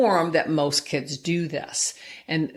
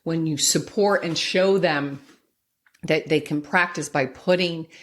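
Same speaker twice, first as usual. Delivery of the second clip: ordinary talk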